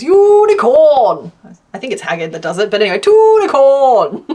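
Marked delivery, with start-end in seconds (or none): 0.0-1.3 slow, drawn-out, louder and higher Unicorn; Haggard saying unicorn. Retold or imitated. Reading his impression of Haggard, slow and loud. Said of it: "my impression of, um, Haggard". 3.0-4.2 slow, loud, high Tunicorn drawn out grandly; Haggard voice. Retold or imitated. Naming the crochet unicorn in the Haggard voice. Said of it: "my impression of, um, Haggard".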